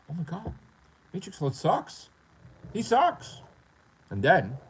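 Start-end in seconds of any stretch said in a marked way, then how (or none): 0.1-3.4 high mock jeering; mocking haters. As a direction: sneer like smug haters. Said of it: "people were like".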